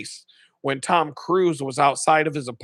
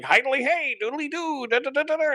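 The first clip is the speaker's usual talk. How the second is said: much higher goofy cheer; Ned Flanders; 10 semitones higher than usual. Retold or imitated. Mocking a churchgoer, much higher goofy Flanders chirp. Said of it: "I'm not Ned Flanders".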